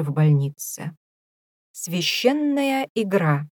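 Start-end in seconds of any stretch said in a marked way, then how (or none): 1.7-3.5 slow grand announcing, a bit higher; announcer voice. Acted. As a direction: proclaim it like a TV announcer. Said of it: "возвестил голос".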